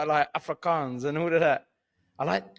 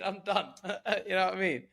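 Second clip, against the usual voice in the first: usual speaking voice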